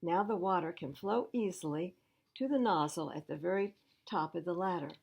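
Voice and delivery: plain reading voice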